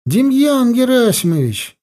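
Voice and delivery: high, urgent call across a hall; mild narrated shout, high-pitched. A narrator's reading. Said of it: "я закричал".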